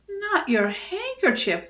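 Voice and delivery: high, slow dismayed wail; mother wails at the ruined handkerchief. A narrator's reading. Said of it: "wailed his mother".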